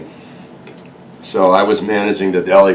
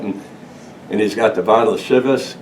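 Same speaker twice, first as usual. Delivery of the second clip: ordinary talk, nothing marked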